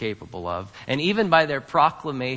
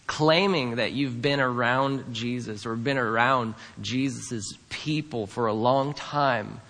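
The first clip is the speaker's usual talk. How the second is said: ordinary talk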